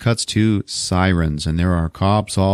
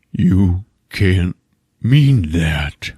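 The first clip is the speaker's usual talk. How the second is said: creepy killer; 3 dB louder, much slower than usual. Retold or imitated. Killer answering his victim, retold.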